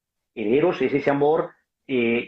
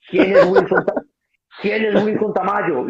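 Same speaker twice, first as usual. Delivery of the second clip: loud high call for the speaker by name; 6 semitones higher, 5 dB louder than usual. Retold or imitated. Big man loudly calling for Wilson Tamayo. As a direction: shout across the room asking who he is.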